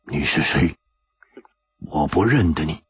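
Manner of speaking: low, husky rasp; hoarse voice. A narrator's reading. Masked man to Chu Liuxiang, low and hoarse. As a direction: rasp it out, shaken and guarded. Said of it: "哑声说".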